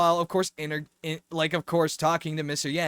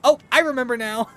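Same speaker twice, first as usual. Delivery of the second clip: higher, louder mock realization; mock sudden recall; 7 semitones higher, 7 dB louder than usual. Joking about the character drawing her sword, mock sudden recall. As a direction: lightly send up her sudden remembering. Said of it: "she was like".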